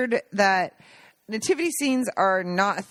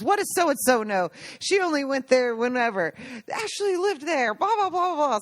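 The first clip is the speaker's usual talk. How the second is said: high-pitched mock argument; mock yelling that runs into blahs; retold yelling; 7 semitones higher than usual. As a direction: imitate a heated rebuttal, climbing higher. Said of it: "starts yelling at her".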